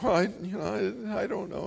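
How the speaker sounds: imitating a scared eight-year-old; timid stammer. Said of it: "I'm like".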